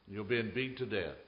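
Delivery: ordinary talk, nothing marked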